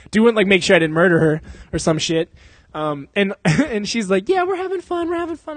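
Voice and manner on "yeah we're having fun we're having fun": high, airy imitation of her saying it's fun; mock girlfriend voice. Guy mimicking his date's unconvincing reply. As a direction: squeak it like a girl faking enthusiasm. Mocking girlfriend's fake cheer.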